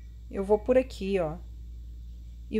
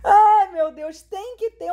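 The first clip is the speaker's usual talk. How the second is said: distressed cry; 8 semitones higher, 7 dB louder, slower than usual. Real, not acted. After being caught.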